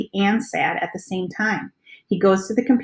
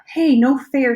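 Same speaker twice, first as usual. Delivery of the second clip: high, louder little-sister whine; sulky protest, pitched up; whining; 7 semitones higher, 7 dB louder, slower than usual. A narrator's reading. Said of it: "she whines".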